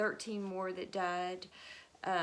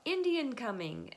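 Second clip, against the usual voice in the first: mock warning shout, higher; retold yell; 6 semitones higher, 4 dB louder than usual. Settler on watch alerting the town, mild mock yell. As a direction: call it out like a lookout. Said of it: "somebody yelled out".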